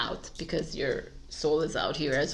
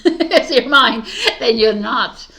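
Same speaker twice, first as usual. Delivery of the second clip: laughing; 4 semitones higher, 10 dB louder than usual. Real, not acted. Laughing mid-sentence while explaining.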